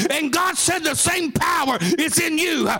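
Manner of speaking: shouted, rhythmic sermon climax at a high pitch; sing-song hollering preacher in full flight; full-force preaching. Acted. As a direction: preach it hard, whooping toward the crowd.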